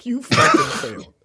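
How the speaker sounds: high laughing jeer; mock her, voice jumping up. Real, not acted. Mock scolding the actress.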